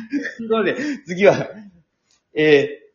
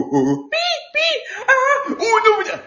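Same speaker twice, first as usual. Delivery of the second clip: squeaky creature voice. Acted. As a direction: squeak like a little puppet. Puppet character voice.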